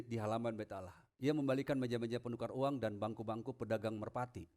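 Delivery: ordinary talk, nothing marked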